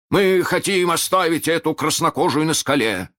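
высокий крик вдаль; прокричи капитану через залив. A narrator's reading. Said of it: "крикнул Неряха". (громкий доклад криком)

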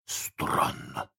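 тихий шёпот без голоса; прошепчи в недоумении. A narrator's reading. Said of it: "прошептал Неряха". (озадаченный шёпот)

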